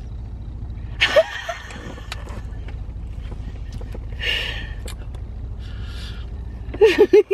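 silent wheezing laugh from sourness; laughing through a sour face; breathless wheezing laugh. Real, not acted. Laughing after eating sour candy.

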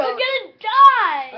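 loud slow dread wail. Real, not acted. Dreading gross bean.

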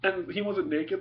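ordinary delivery

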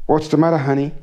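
a hint of the caring wife asking; wife's voice.

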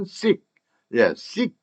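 "seek" for "sink", the nasal dropped, K stopped short; reduced "sink". Shown as a demonstration. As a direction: cut "seek" off on an unreleased K. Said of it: "in이라는 발음은 날아가고".

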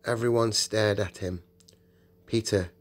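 plain reading voice

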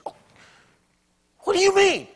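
high, loud offended protest; mock indignant listener. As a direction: play the defensive churchgoer. Imagined listener objecting.

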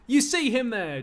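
high, loud indignant cry; loud indignation. Acted. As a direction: point him out in outrage. Slave complains to Achilles.

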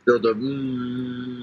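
slow, drawn-out mock of the stalled words; mocking imitation. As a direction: drag it out teasingly, copying his hesitation. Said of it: "mimicking S0's stalled phrase".

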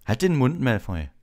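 high start, falling crossly; angry. A narrator's reading. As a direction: snap at a rival to shut up. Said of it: "sagte Harry wütend".

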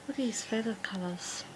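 soft unvoiced whisper. Real, not acted. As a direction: hush so the bird stays calm. Whispering near the bird.

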